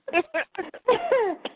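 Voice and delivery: high, pleased laugh; real high laugh. Real, not acted. Teller laughing at her nickname Bad Baby, high pleased laugh. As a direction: laugh at your own punchline.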